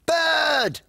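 high loud joyful call. Acted. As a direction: call out to the bird. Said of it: "called Flo".

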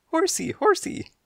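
very high, squeaky little kitten; high-pitched kitten babble; tiny kitten voice. Acted. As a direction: chirp it like a sleepy kitten. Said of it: "and giggled and yawned".

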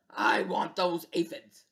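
grumpy growling bug voice; a grumpy little bug demanding its food; grouchy ladybug. Acted. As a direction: sound greedy and cross. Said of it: "shouted the grouchy ladybug".

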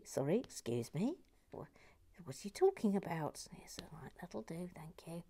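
soft, half-whispered grumble under the breath; muttered aside. Pretend. Host to imagined hecklers, muttered aside. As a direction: mutter it away from the audience.

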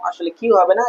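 ordinary talk, nothing marked

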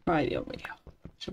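soft whispered sigh of relief. Real, not acted. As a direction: breathe it out relieved.

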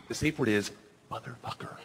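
secretive, sinking into a near-silent whisper; hushed reluctant admission. Acted. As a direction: drop low, then whisper the swear word. Reluctant to say swear aloud.

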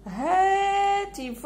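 هاتف with the alef held very long, slow; stretched long vowel. Shown as a demonstration. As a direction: draw out the هَا in هاتف to show the long vowel. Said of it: "هاوليك المد في الألف".

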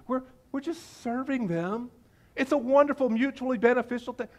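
high, airy pretend innocence; mock innocent. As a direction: play naive, sugar-coating it. Mocking the Israelites' rosy redefinition.

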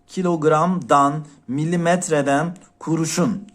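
slow example. Shown as a demonstration. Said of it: "bakın gör arkadaşlar".

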